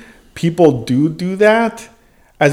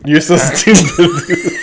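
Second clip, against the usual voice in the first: laughing. Real, not acted. Still laughing at joke.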